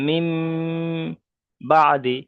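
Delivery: "মিম" held long in a nasal hum before "বাদি"; ghunnah demonstration. Shown as a demonstration. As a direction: hum the final m of "মিম", then release firmly into "বাদি". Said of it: "মিমটা একালি পরিমাণ গণ্য হবে".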